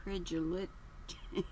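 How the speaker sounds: ordinary delivery, nothing marked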